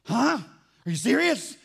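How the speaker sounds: wife's high, loud disbelief; surprised wife. Retold or imitated. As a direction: imitate the wife, stunned and thrilled. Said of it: "She goes".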